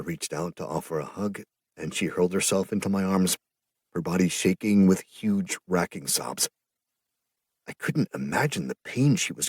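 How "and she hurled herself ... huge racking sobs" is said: ordinary delivery, nothing marked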